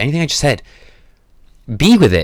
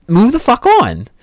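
higher, slow, punchy command; forceful exclamation; 10 semitones higher, 3 dB louder, slower than usual. Real, not acted. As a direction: hit it hard and drawn out. Forceful exhortation climax.

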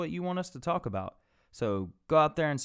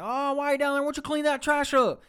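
much higher, louder sneering cop; high mock-taunting cop voice; 7 dB louder than usual. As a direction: ape the smug officer ordering him. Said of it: "he's like".

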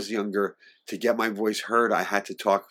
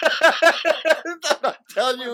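teasing refusal; 7 dB louder, much slower than usual. Real, not acted.

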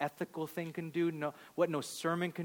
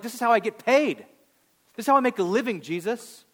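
higher and louder, hammed-up brazen retort; mock defiant excuse; 9 semitones higher, 10 dB louder, faster than usual. Pastor voicing the woman's imagined excuse, in mock brazen defiance. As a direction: toss it back like a cheeky excuse.

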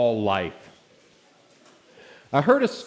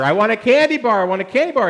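put-on higher, louder whining toddler demand; whiny kid yelling; 8 semitones higher, 6 dB louder, faster than usual. Toddler in the cart yelling at his dad for candy, whiny and loud. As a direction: whine it like a three-year-old wanting candy. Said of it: "had gotten a little bit louder".

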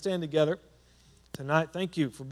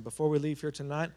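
ordinary delivery

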